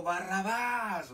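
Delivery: drawn-out mock shout of a mob; retold crowd shout. Preacher imagining himself in the crowd before Pilate, mock shout. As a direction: cry out the name slowly, imitating the crowd. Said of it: "hubiéramos gritado".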